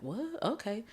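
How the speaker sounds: high, quiet puzzled "what?", then giving in; softly baffled, reluctantly going along; retold hesitant surprise; taken aback. Reacting to friend's idea to pray.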